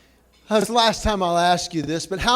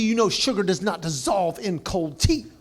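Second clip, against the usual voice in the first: ordinary delivery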